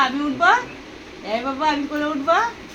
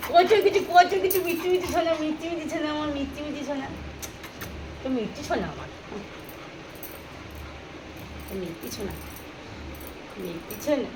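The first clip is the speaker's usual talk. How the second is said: tender cooing; 6 dB quieter than usual. Real, not acted. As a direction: coo softly over a beloved pet. Cooing at the pet.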